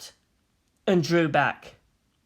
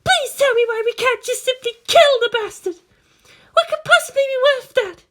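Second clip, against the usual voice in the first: high, louder, furious demand; enraged Maya; 7 dB louder than usual. Acted. Maya furious that they cannot kill Gendo. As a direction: demand it through rage.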